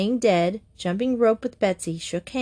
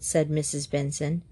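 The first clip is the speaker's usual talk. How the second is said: ordinary delivery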